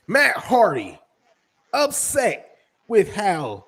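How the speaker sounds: loud, punchy headline shout; announcer-style topic call. Real, not acted. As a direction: belt out the name like a headline.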